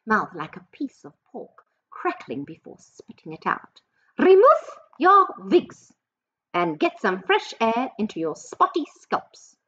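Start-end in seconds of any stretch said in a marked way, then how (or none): 4.2-9.6 shouted witch order. Acted. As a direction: bellow it, then let it settle. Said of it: "she shouted".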